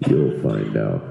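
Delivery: very low ominous boss line; deep gruff voice, ominous; mock deep boss voice. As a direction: drop way down, menacing tease. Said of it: "He goes".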